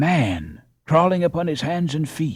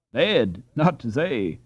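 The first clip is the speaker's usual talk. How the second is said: ordinary delivery, nothing marked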